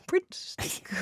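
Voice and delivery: put-on shy giggly girl.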